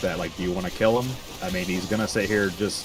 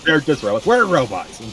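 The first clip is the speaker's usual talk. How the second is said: high, loud mock outrage; pitched-up, loud indignant shout; exasperated; 7 semitones higher, 6 dB louder than usual. Real, not acted. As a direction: shout him down in disbelief. Repeats the outburst.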